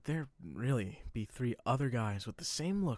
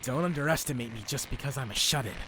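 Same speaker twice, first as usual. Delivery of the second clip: heated, raised-voice swagger; fighting boast; 4 semitones higher than usual. Acted. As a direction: brag hotly at the opponent. Subaru boasts mid-fight.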